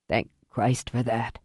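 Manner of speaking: low, soft, weakened voice; dying father. Acted. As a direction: speak low with failing strength.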